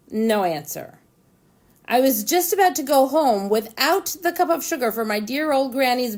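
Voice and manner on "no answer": ordinary delivery, nothing marked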